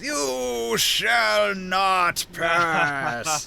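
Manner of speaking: high, hugely drawn-out proclamation; defiant druid. Acted. Druid blocking attackers with grasping vines, grand drawn-out cry. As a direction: stretch every word into a grand, wizardly command. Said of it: "The druid throws down grasping vines and says".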